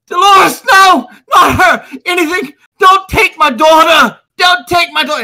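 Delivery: high, loud, pleading cries of a father; desperate father. Acted. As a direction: beg at full voice for your child. Father begs for his daughter.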